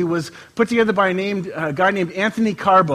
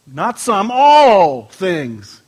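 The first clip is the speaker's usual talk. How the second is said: slow, louder solemn reading; scripture reading; 5 dB louder, much slower than usual. Acted. Pastor reading John to the church, slow solemn scripture. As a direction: read it out like holy writ, slowly.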